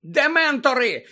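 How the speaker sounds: high, loud cry of alarm; screaming місіс Фіг. A narrator's reading. Old woman breaking news to her lookout, screaming. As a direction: shout the word in outrage. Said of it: "закричала місіс Фіг".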